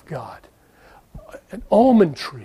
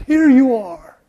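higher, louder, grand offering; grand voice of God; 6 semitones higher, 6 dB louder, slower than usual. Acted. Preacher voicing God giving the gift, louder. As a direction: hand it over with a big flourish.

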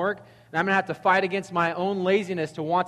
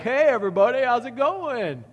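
casual greeter; 8 semitones higher, 6 dB louder than usual. Pretend. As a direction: play Jesus breezing in with a big hello. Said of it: "show up and be like".

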